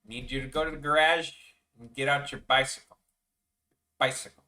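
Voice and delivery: put-on Chicago accent. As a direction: say it like a big Midwest dad. Continues the put-on voice.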